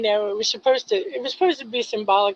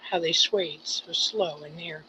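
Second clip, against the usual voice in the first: poem reading; 4 semitones lower than usual. Acted. Reads his own poem aloud.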